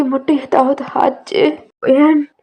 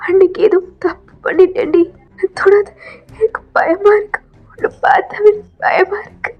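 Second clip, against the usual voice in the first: a hint of frightened tears, pitched higher; scared, recoiling; 6 semitones higher than usual. Daughter recoils from the man who hurt her, frightened. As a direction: push him away in fear.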